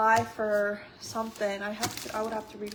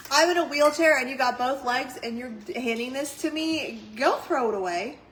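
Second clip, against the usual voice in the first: loud, fed-up order; scolding a kid; 3 semitones higher, 7 dB louder than usual. Retold or imitated. Ordering a friend's kid to throw trash away, loud scolding. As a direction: tell the kid off, loud and exasperated. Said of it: "I'm like".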